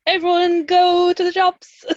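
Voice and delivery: high, slow, put-on announcer voice; mock cheery announcement. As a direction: sing it out like a jolly public notice. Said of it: "we went from ... to".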